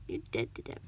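low, menacing sneer. A narrator's reading. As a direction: finish the threat deep down. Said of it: "the man sneered".

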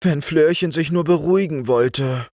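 high, fretful motherly lament; sighing worry. A narrator's reading. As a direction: wish it aloud as a worried mother. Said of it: "seufzte die Mutter".

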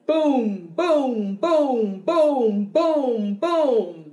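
booming drumbeats, each one falling; low drum voice. Shown as a demonstration. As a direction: boom steadily like a drum. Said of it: "low voice that sounds like a drum".

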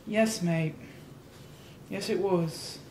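lightly put-on British accent, soft; a touch of British, quiet and amused; mock British accent. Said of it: "hitting with the British accent".

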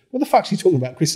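turning to Chris, demanding an answer; retold heated demand.

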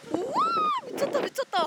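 high amazed cry over the wind; excited exclaim. Real, not acted. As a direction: shout it out in amazement. Wind suddenly very strong.

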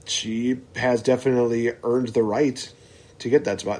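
ordinary delivery

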